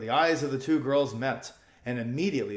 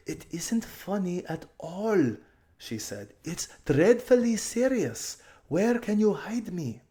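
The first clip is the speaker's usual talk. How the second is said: higher, pressing and hurried; urgent girl; 8 semitones higher, slower than usual. A narrator's reading. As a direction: beg her to act fast. Aravis serious.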